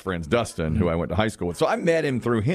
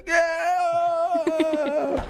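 high excited yeah. Retold or imitated. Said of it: "Then he's like".

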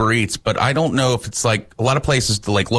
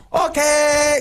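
much higher, mock Chinese-accented holler. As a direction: mimic the accent in a high squeal. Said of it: "in a mocking accent way".

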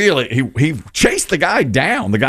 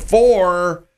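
higher, drawn-out retold shout; mock golf yell; 6 semitones higher than usual. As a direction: imitate a heckler yelling, without real volume.

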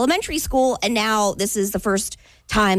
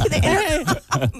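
ordinary delivery

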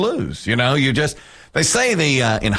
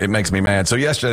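usual speaking voice

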